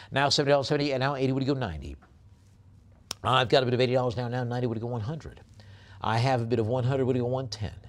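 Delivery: fast, high, loud auctioneer chant; rapid-fire auction call; auction bid calling. Acted. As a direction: rattle off the bids like a live auctioneer.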